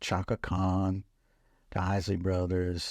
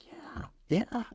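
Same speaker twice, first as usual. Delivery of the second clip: high breathy whispered yeah, yeah; soft, hushed and shy; 13 semitones higher, 3 dB quieter than usual. Retold or imitated. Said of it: "very soft-spoken man".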